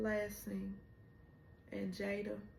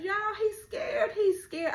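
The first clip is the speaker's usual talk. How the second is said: much higher and louder, laughing and crying; excited squeal; 12 semitones higher, 9 dB louder than usual. Real, not acted. Fan telling viewers about Nook's fear, squealing with laughter. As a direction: shriek it in delight, half-laughing.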